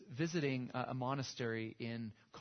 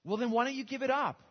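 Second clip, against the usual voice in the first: much higher, louder pressing plea; urgent plea; 11 semitones higher, 9 dB louder than usual. Retold or imitated. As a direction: push him hard to let it go. Visiting monk presses the gunman.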